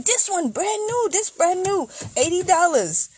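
high-pitched mock sales pitch; pushy seller. Voicing the tire seller's pitch.

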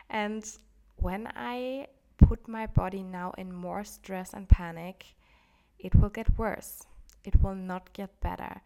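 building emphasis, loud at the end; emphatic warning. Real, not acted. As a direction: push the warning harder each phrase. Warning against panic, rising intensity.

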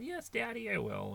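put-on three-year-old daughter answering her father in a tiny voice; little girl voice. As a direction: pipe it like a small girl.

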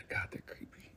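hushed whisper aside; breathy creeped-out whisper. Real, not acted. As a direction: whisper it like it spooks you. Aside about creepy pictures.

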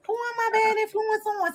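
high, whiny protest; mock-offended. Accused friend still asking for an answer, mock-offended. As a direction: whine the question in mock hurt. Repeats her offended question.

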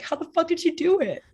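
high-pitched, mock-tearful exclamation; teary disbelief. Best friend tearing up over a couple's romance, teary disbelief. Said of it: "started getting teary and she's like".

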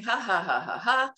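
deliberate ha-ha laughing; steady put-on laugh; demonstrated laughter. Shown as a demonstration. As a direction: act out laughter-yoga laughing. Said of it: "but I'm laughing".

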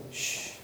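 soft hushing shh; quiet them with a soft shh. Pretend. The Lord hushing a worried listener, soft shh. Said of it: "That's the Lord saying".

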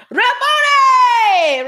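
very high, loud, drawn-out cry; hammed-up joyful holler, stretch it right out. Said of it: "cried out in Arabic".

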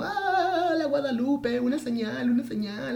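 high, wailing sob-singing; crazy crying. Retold or imitated. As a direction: wail it out like a woman gone mad with emotion. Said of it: "me pongo a llorar así como tipo loca".

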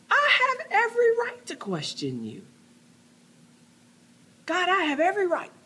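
high, laughing mock protest; mock complaint. Preacher imitating believers complaining to God, high mock protest. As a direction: ham up a whiny believer's protest. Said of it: "we say".

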